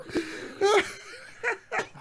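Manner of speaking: high, crying laugh; helpless laugh. Real, not acted. Cohost fooled by the prank, high crying laugh. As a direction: laugh until it almost hurts.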